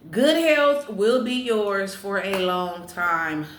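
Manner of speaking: slow, deliberate read-out; measured announcement; fortune proclamation. Real, not acted. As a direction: give each word of the fortune room. Repeats fortune for viewers.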